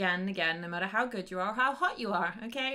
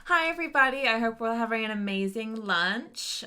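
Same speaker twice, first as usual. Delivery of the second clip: cheerleader voice; 3 semitones higher than usual. Acted. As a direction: cheer captain greeting the whole room. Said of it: "You use your cheerleader voice".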